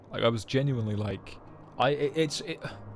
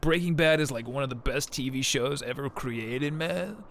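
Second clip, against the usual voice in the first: dude bro; 3 semitones higher than usual. Retold or imitated. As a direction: gush like a stoked dude. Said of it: "I'm like".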